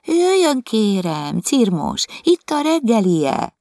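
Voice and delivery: high, sweet, overly polite coaxing; coaxing the cat. Retold or imitated. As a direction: mimic the maid calling the kitten. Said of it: "és hívogatja".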